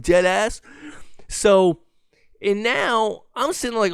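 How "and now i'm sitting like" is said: usual speaking voice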